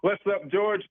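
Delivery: high, laughing greeting. Real, not acted. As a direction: burst out laughing as the disguise drops. Guest revealed, greets host laughing.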